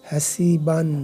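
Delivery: normal talking voice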